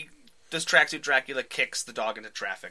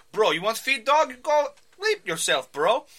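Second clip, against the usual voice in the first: put-on loud, high Russian-thug accent, bro; tracksuit thug accent; 12 semitones higher, 7 dB louder than usual. Podcast host voicing the Russian thug threatening Clint, loud Russian accent. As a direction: bark it like an Eastern European tough guy.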